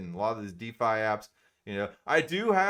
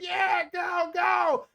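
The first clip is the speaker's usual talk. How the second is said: much higher cheering shout; cheering crowd; much slower than usual. Retold or imitated. Poolside crowd cheering the scared kid on the diving board. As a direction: yell up at the kid to jump. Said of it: "everybody at first like".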